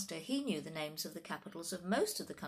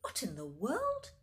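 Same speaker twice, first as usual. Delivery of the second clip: slow, higher astonished question; gasped; 5 semitones higher than usual. A narrator's reading. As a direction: draw it out in shock. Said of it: "gasped the teacher".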